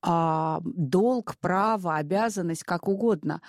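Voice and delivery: normal talking voice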